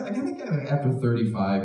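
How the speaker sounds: ordinary talk, nothing marked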